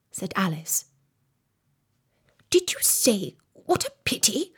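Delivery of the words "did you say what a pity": high, breathed query; whispered question. A narrator's reading. Rabbit checks what Alice said, whispering. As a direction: ask it in a half whisper.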